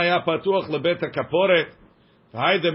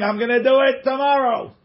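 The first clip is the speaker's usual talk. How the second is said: high, raised-voice announcement; mock scream; 8 semitones higher, 4 dB louder than usual. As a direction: call it out loud, pitched way up.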